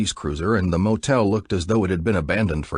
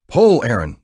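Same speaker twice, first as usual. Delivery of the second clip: loud encouragement; 5 semitones higher, 7 dB louder than usual. A narrator's reading. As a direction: urge her on, louder. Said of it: "Buck encouraged".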